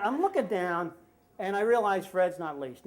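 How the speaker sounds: ordinary talk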